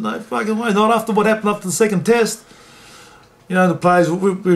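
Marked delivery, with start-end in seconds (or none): 0.0-2.4 raised, higher outraged protest; indignant refusal. Retold or imitated. Player refusing the coach's order, raised and indignant. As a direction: protest hard against the coach.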